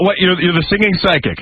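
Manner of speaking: ordinary talk, nothing marked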